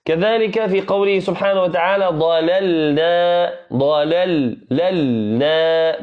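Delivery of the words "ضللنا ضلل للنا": "ضللنا" very slow, each ل said distinctly; clear double lam. Shown as a demonstration. Teacher modeling the two lams for the students. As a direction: pronounce both lams in "ضللنا". بين اللام الأولى واللام الثانية.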